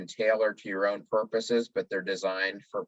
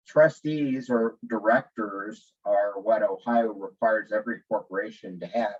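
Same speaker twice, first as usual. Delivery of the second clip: ordinary delivery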